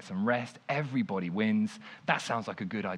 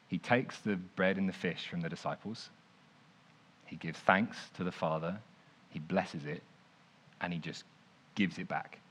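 quiet, deliberate retelling; 6 semitones lower, 8 dB quieter than usual. Shown as a demonstration. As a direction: lower and hush it down. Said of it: "Except that's not what happens".

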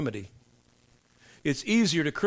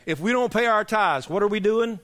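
pushing the question out louder to the crowd; louder call; 6 semitones higher, 4 dB louder than usual. Real, not acted. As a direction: call it out louder, rallying the congregation. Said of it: "I want you to scream it at me".

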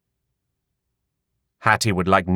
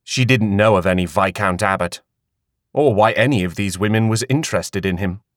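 ordinary delivery, nothing marked

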